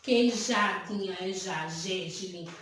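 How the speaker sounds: low, unhurried sounding-out of "já" inside "Queijadinha"; same J sound shown. Shown as a demonstration. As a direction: draw out the zh shared by "Queijadinha", "já" and "gergelim". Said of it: "É o mesmo som".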